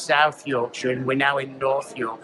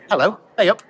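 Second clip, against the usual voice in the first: a cheerful Yorkshire "hey up", rising, a bit higher; Yorkshire greeting demo; 5 semitones higher, 5 dB louder, faster than usual. Shown as a demonstration. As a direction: say "Hello, hey up" like a friendly Yorkshireman. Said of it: "It's basically a way of saying".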